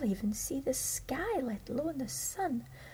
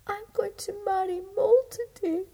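high, louder tearful voice breaking into a cry; sobbing words of a tiny girl on her wedding day; weeping; 9 semitones higher, 7 dB louder than usual. Acted. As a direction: cry it out as a trapped bride. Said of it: "said Thumbelina, weeping".

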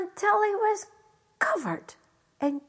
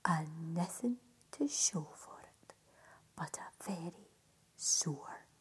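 hushed storytelling; 7 semitones lower than usual. A narrator's reading. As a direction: let the ending fade to a whisper. Hushed close of the tale.

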